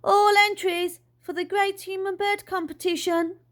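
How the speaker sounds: high, sustained public call; booming voice. A narrator's reading. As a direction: announce it to the whole street. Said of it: "The voice boomed out again".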